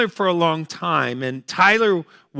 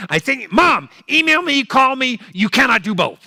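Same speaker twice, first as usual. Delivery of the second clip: exasperated son; 7 semitones higher than usual. Retold or imitated. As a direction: lose patience with mom. Son snapping at mother.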